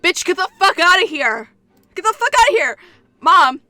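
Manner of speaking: high, loud mock-furious shout; outraged yelling. Reader shouting at the mom character, hammed-up outrage. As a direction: yell to throw her out.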